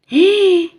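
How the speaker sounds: sharp high gasp of surprise; shocked gasp. Acted. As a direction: catch your breath at the discovery. Finds money bag.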